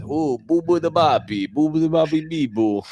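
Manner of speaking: high, quick mock-Italian babble; Italian meme gibberish. Busker mocks the Italian speech meme, high and fast. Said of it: "the meme that Italian is".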